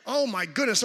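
ordinary delivery

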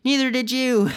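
high, indignant retort; acted squabble; bickering comeback. A narrator's reading. Acted squabble between the boys.

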